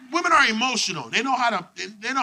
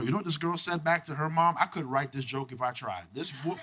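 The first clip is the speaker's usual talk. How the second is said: ordinary talk, nothing marked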